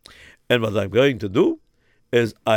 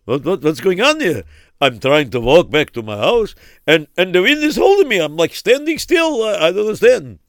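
high, loud alarmed cry; panicking against the wind; 6 semitones higher, 7 dB louder than usual. Acted. Fighting wind, confused.

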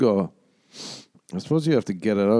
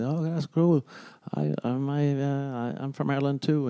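lightly voiced waiter, higher pitched; waiter's voice; 5 semitones higher, 4 dB quieter than usual. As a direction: imitate an eager-to-connect waiter, pitched up. Acting out the Irish waiter.

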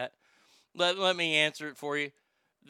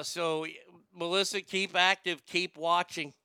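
ordinary delivery

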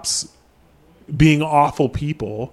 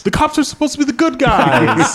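high, loud incredulous echo through laughter; laughing disbelief; 12 semitones higher, 4 dB louder than usual. Real, not acted. Co-host asks if cops are heroes, laughing incredulous echo. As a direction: laugh it back at the co-host, can't believe it. Echoing a co-host's naive question.

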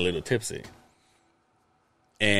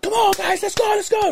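imitated screaming fan. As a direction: yell it like a passionate football fan. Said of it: "It's just, like, screaming".

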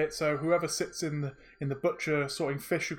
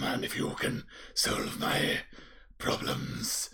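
breathy, whispered threat; eerie whisper; 13 semitones lower, 5 dB quieter, slower than usual. Acted. Magic mouth whispering terms to the players, eerie. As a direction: whisper the condition like a secret riddle. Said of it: "I will try my best to voice act".

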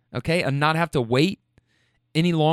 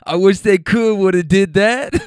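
passenger's line through a laugh, louder; laughing quote; 3 semitones higher, 6 dB louder than usual. Retold or imitated. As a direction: quote him mid-laugh.

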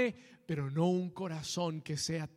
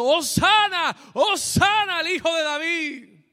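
high, loud cheer of a welcoming crowd; ringing, full-voiced acclamation; crowd shouting acclaim; 10 semitones higher, 10 dB louder than usual. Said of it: "estaban gritando".

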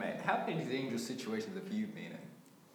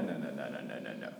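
descending no; 11 semitones lower, 3 dB quieter than usual. Acted. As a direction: pitch drops far down. Mock expert dismisses idea.

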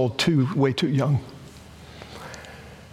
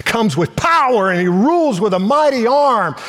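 raised, loud proclamation of power; proclaiming; 8 semitones higher, 5 dB louder than usual. Acted. Preacher reading Isaiah, loud and high. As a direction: thunder out God's mighty power.

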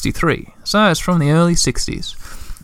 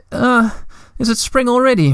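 high, drowsy little troll voice, lightly voiced; half-asleep mumble, pitched up; sleepy mumble; 9 semitones higher than usual. A narrator's reading. Moomin Troll waking from hibernation, drowsy mumble. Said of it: "Moomin Troll mumbled".

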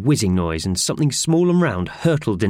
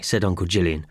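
ordinary delivery

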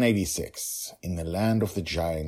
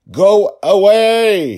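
long, slow, high scream; a father's drawn-out desperate scream; 17 dB louder, much slower than usual. A narrator's reading. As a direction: scream it at the forest with everything. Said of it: "he screamed at the forest".

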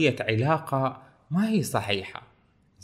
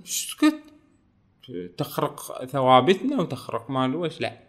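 mock silencing; 2 semitones higher than usual. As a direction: shush him like an angry censor. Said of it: "قال له". (إسكات ساخر بصوت عالٍ حاد)

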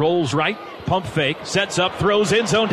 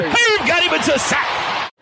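excited call; 6 semitones higher than usual. Real, not acted. Sack called live.

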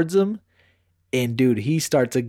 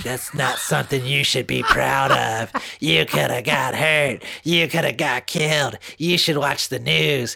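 imitated scolding mom. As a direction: imitate mom laying out each warning. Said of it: "I call my mom".

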